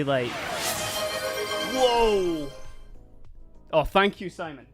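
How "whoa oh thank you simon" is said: normal talking voice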